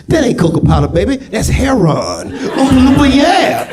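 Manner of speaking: high shouting dealer Oompa Loompa; hustler Oompa Loompa. Pretend. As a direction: hype it like a street dealer. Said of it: "Here come the Oompa Loompas".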